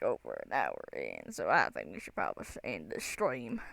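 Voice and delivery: low, put-on southern accent. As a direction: slip into an accidental southern accent, dropping low. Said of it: "I don't know why I turned southern".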